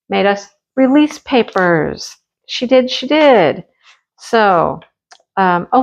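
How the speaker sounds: ordinary delivery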